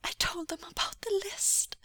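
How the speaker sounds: high, thin, tearful voice; fragile, high-pitched admission; whispered confession. Acted. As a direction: confess it in small, frightened voice. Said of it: "he whispered".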